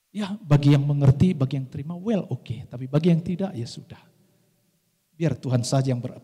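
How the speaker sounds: normal talking voice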